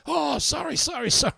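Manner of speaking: high, flustered apology as the cleaner. Retold or imitated. Cleaner caught out, apologising in a high fluster. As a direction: squeak out a rushed sorry as the cleaner. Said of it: "He's gone".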